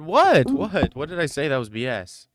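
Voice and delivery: high loud indignant what. Real, not acted. Streamer to chatter threatening to unsub, loud indignant disbelief. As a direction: shoot back in loud disbelief.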